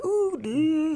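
high startled ooh, dude; a sudden worried jump up; alarmed ooh. Retold or imitated. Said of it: "I'm a little bit like".

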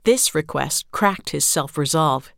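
ordinary delivery

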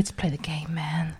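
hushed unvoiced aside; whispering. Real, not acted. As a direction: whisper it to the listeners.

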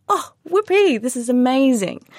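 high, loud, thrilled squeal; delighted. Retold or imitated. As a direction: gush with sudden joy. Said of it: "you're like".

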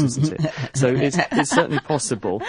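chuckling through the words after a joke; light real laughter while talking; laughing. Real, not acted. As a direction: laugh it out, amused at own quip. Joke about soap opera accents.

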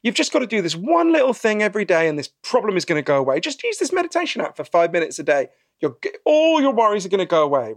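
high, bright-pitched sales patter; cheery salesman pitch. Retold or imitated. Salesman pitching a miracle fix to people, high and chirpy. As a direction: sell the quick fix like an overeager huckster. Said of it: "you say to people".

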